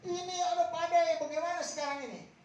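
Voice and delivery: high, loud desperate cry to God; panicked prayer. Retold or imitated. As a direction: plead like the boat is sinking. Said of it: "datang kepada Yesus".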